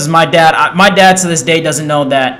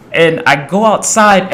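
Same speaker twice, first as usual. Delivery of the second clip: ordinary delivery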